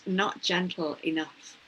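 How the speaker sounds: ordinary delivery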